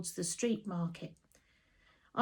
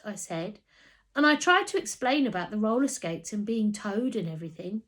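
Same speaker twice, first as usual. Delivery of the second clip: plain reading voice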